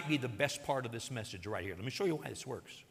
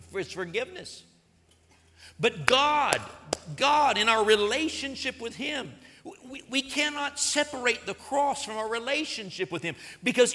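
higher, louder preaching build; full-force preaching; 6 semitones higher, 3 dB louder than usual. Acted. Preacher urging church to keep cross central, full force. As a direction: drive it home from the pulpit.

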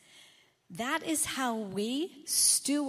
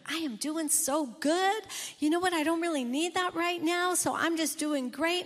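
cocky self-assurance; 5 semitones higher than usual. Acted. Preacher voicing a believer who thinks he's fine, cocky. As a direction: brag that you need nothing now. Believer drifting from God, voiced.

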